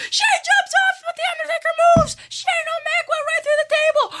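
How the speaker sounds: high, shouted, breathless calling; thrilled play-by-play. Pretend. Fan calling Reigns spearing Stephanie, thrilled shout. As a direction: scream it like the crowd going crazy.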